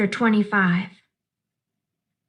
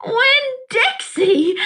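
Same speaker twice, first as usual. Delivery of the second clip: much higher, slow, joyful holler; 12 semitones higher, much slower than usual. Acted. Girl calling her found dog, overjoyed holler. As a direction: call his name out with pure relief. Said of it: "I hollered".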